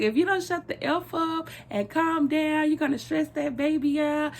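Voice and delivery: high-pitched mock scolding; acted-out exasperated mother; imitating yelling mama. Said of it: "when she was yelling".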